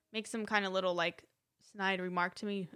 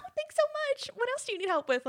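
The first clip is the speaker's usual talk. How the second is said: very high, louder fake-sweet service voice; mock gushing gratitude; 13 semitones higher, 5 dB louder, much faster than usual. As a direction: thank them with hammed-up politeness. Mock gratitude she would never give.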